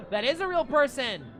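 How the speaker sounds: loud, high shocked exclamation; surprised. Real, not acted. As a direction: blurt it out in disbelief. Realizes actor is real.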